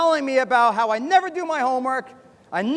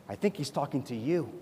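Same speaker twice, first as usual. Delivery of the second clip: very low, hushed nudge; confidential aside; 8 semitones lower, 10 dB quieter than usual. Acted. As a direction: lean in and slip it to your neighbour quietly. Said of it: "makes me want to lean in".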